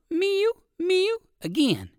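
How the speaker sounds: high mewing like a cat. Shown as a demonstration. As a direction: mew high like a cat bird. Narrator imitates cat bird.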